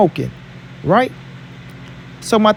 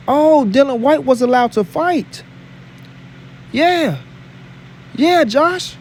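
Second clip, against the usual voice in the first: slower, higher-pitched sarcastic taunt; mock surprise; 5 semitones higher, slower than usual. Real, not acted. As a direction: draw it out with pointed mock amazement. Taunting Josh over double standard.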